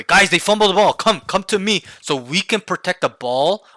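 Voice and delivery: rally the team to you urgently; high, forceful demonstrated call-out. Shown as a demonstration. Demonstrates calling out to teammates.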